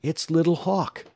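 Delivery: high-pitched, excited exclamation; exclaiming. A narrator's reading. Alex spots the rider from the creek, exclaiming. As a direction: exclaim it in sudden recognition, pitch up. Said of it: "Alex exclaimed".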